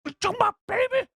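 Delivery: raptor hissy fit. Retold or imitated. Blue the raptor blaming Owen, high shrieking hissy fit. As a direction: squeal it like an outraged raptor mum. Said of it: "has a full-on hissy fit".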